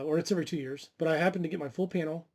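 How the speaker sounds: usual speaking voice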